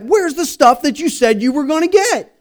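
high-pitched comic complaint; mock upset. Husband asks his wife about forgotten shopping, upset. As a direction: demand it high, playing aggrieved.

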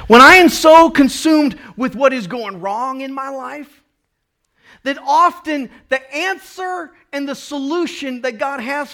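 preaching it again with force; emphatic. Real, not acted. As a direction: drive the point home loudly. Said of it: "same words, louder retake".

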